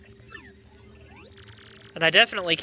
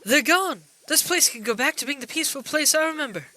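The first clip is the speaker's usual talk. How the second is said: put-on high, quick old-lady voice; 7 semitones higher than usual. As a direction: pitch it up as a relieved old lady. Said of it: "that was the old lady talking".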